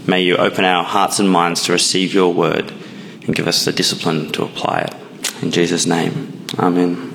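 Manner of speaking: low, hushed prayer voice. Acted. Opening prayer before the sermon.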